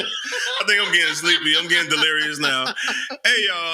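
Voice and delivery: high, laughing, giddy. Real, not acted. Host giddy with laughter at the end of the show. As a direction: talk through giggles, voice high.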